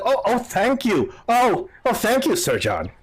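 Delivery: high, flattered thanks dropping at the end; impressed prosecutor. Acted. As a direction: thank them as if pleasantly taken aback. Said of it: "the prosecutor looks very impressed".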